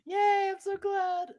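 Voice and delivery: high, slow, drawn-out yay; delighted cheer. Real, not acted. Delighted that friend liked shirt.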